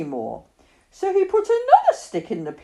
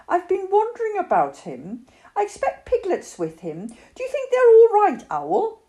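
lightly voiced child, pitched up; a hint of a concerned boy asking a friend; higher, lightly worried character voice; Christopher Robin; 12 semitones higher, 5 dB louder than usual. A narrator's reading.

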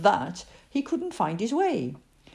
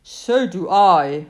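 slow Pooh; 2 semitones lower, 8 dB louder, slower than usual. A narrator's reading. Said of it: "said Pooh".

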